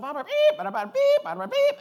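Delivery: mock comic bleeping rant; imitating bleeped comedian. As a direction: mimic a routine full of bleeps. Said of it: "And he was like".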